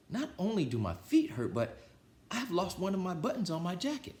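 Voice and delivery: high, whiny toad voice; Toad complaining. Acted. Toad whining to Frog about his lost button, high voice. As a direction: fuss about sore feet and a lost button. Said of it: "said Toad".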